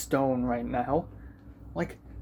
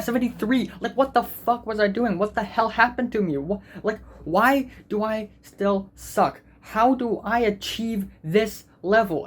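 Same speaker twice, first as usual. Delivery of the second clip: angry rant; 6 semitones higher than usual. Real, not acted. To viewers about a seventy-three score, an angry venting rant. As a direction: let the anger at yourself spill out. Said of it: "I'm angry".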